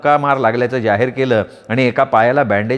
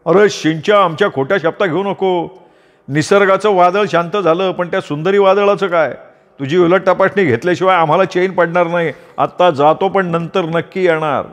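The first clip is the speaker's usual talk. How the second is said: put-on higher, nosy old uncle voice scolding; a busybody uncle wagging his finger; meddling old uncle; 5 semitones higher than usual. Uncle accusing Makya of an affair, higher meddling voice.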